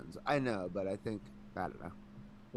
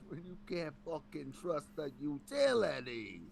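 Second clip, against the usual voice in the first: put-on slow New York accent, a bit higher; 7 semitones higher, slower than usual. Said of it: "a thick, like, New York accent".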